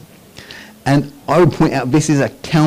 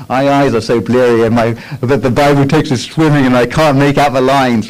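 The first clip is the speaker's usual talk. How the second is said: a touch louder, mock weary grumble; sleepy pray-er complaining; 3 semitones higher, 5 dB louder than usual. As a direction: play a drowsy believer squinting at the page. Voicing a sleepy early-morning prayer.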